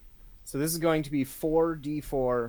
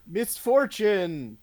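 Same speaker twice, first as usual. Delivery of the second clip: high, loud, theatrical cry; dramatic in-character exclamation; 6 semitones higher, 5 dB louder, much slower than usual. Acted. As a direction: draw it out slowly, high and loud. Player speaks as his character.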